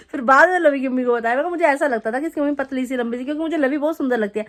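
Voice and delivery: ordinary talk, nothing marked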